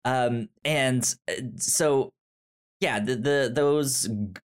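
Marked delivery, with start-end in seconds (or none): none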